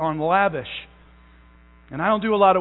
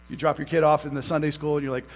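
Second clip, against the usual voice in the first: ordinary talk, nothing marked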